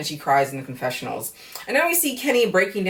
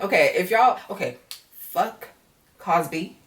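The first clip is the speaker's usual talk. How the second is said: ordinary talk, nothing marked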